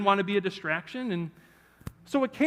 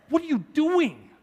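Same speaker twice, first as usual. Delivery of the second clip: high, louder disbelief at himself; talking to his reflection, higher in disbelief; startled self-scolding; 10 semitones higher, 4 dB louder, slower than usual. Retold or imitated. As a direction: cry out in shock at your own reflection. Shocked at himself in window.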